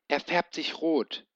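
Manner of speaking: hoch und aufgeregt. A narrator's reading. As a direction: erschrocken warnen. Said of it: "rief sie aufgeregt". (Schwefelfell ruft aufgeregt zu Ben)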